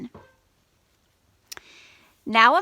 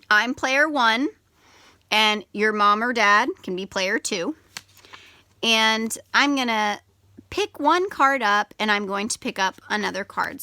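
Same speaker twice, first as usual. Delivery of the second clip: usual speaking voice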